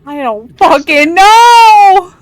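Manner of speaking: loud, slow, exasperated yell; exasperated shout. Real, not acted. GM exasperated by ceiling question.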